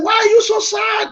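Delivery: put-on a prophet calling out a woman he thinks is drunk; prophet scolding. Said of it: "the prophet said".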